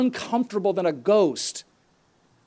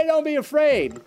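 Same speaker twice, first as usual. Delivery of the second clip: laughing mock dog owner, pitched higher; 8 semitones higher than usual. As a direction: send up the owner's breezy reassurance. Dog owner's useless reassurance.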